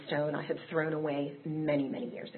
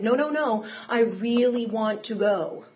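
higher and louder, slowed and pressing; eager insistence; 5 semitones higher, 4 dB louder than usual. Retold or imitated. Newcomer assuring him he wants the meeting, eager. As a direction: insist you really want it. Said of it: "And I said".

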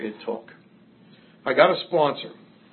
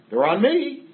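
much higher and louder, a whooping offer; boisterous drunk boast; 11 semitones higher, 5 dB louder, slower than usual. Retold or imitated. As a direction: crow it to the whole bar. Drunk at the club, buying rounds.

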